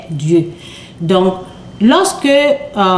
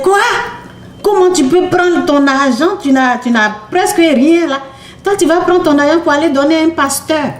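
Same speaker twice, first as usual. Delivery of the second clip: incredulous scoffing; 6 semitones higher than usual. Retold or imitated. Teen girl scoffing at mother about tithing, incredulous and fast. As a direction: scoff at the idea of giving money away.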